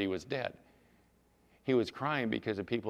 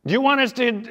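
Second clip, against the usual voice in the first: high, loud offer to the boss; loud eager question; 12 semitones higher, 10 dB louder, faster than usual. Acted. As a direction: pitch it up, pressing for orders. Warriors question Joshua about the plan.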